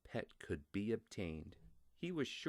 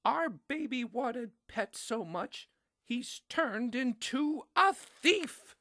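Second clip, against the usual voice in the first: much higher and louder, slowing at the end; mom's firm belief; 9 dB louder, slower than usual. A narrator's reading. Mom telling dad their baby turned thief, high, loud, slowing. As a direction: announce the verdict with dismay. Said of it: "said it was her firm belief".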